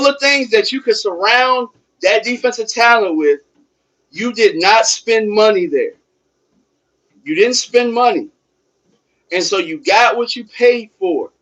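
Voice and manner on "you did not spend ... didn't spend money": ordinary talk, nothing marked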